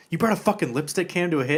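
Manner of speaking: brisk, exasperated mock rebuke; mock berating. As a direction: tell him off in disbelief. Boss berates henchman over camera.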